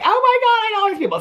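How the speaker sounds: high-pitched thrilled squeal; giddy squeal. Retold or imitated. Vlogger quoting her own excited reaction, high squeal. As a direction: squeal it like a starstruck fan. Excited self-quote about knowing cast.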